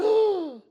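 sharp high loud gasp; loud shocked intake of breath; crowd gasp. Retold or imitated. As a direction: gasp hard in astonishment. Crowd gasps as lock opens.

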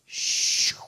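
breathy imitated pew hiss; whispered shoo. Preacher imitating the pew closing around the lady, unvoiced shoo. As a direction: puff it out like air leaving.

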